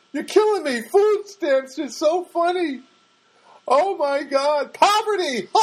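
high, slow, giddy mock laugh through the words; mocking giggly laughter. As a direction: ham up a girl who finds everything hilarious. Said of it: "this girl was just laughing up a storm".

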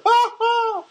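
high, helpless laugh; high burst of laughter. Real, not acted. Cracking up after telling his own purple-leg joke. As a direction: let a real laugh burst out. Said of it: "I'm dying of laughter".

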